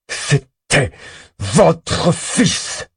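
crache chaque syllabe, plus aigu et plus fort; en crachant chaque syllabe. Acted. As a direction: chaque syllabe jetée avec mépris. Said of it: "en crachant chaque syllabe". (il crache au tueur chaque syllabe avec rage)

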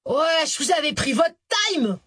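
high, annoyed outburst; angry exclamation. A narrator's reading. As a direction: snap it out in irritation. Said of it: "il ne put retenir une exclamation de colère".

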